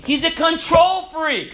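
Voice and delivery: high, loud, stretched-out verdict; mock-exasperated cry; exasperated outcry. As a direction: blurt it out as if it's obvious. Exclaimed rebuttal of woman's excuses.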